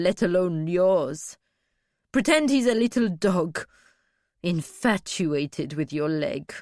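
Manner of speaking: cold, mocking dismissal; cruel satisfaction. A narrator's reading. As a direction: belittle her fear with relish. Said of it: "Was there a cruel satisfaction in Dwalia's voice?".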